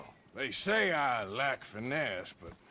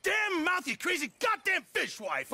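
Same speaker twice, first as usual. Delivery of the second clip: angry shout; 14 semitones higher than usual. Acted. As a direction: blast her with rage. Man yells at quarrelling woman.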